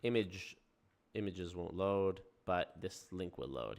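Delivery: ordinary delivery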